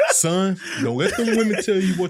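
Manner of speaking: put-on laughing old man voice; loud, laughing wise old father. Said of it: "I'm just getting that old man voice".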